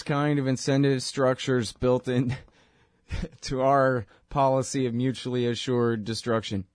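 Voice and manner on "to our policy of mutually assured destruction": normal talking voice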